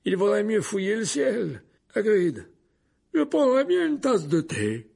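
high, loud, playful suggestion; amused. A narrator's reading. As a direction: suggest it merrily, pitched well up. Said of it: "dit Dumbledore d'un ton toujours amusé". (amusé, voix haute et sonore)

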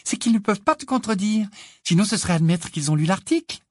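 high-pitched, gleeful confiding; bubbling with glee, voice lifted high; joyful. A narrator's reading. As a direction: share it with delight. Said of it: "chuchota Hermione d'un air joyeux".

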